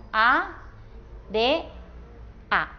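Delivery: «A, D, A» como área silabeada: a, de, a, con pausas largas; separado por sílabas. Shown as a demonstration. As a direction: di cada sílaba de «A, D, A» aislada. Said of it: "se separarían en dos sílabas diferentes". («A, D, A» silabeado muy despacio, a... de... a)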